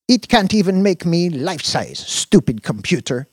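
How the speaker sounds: high pouting complaint; pouty girlfriend. A narrator's reading. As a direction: sulk about the machine. Dina pouting.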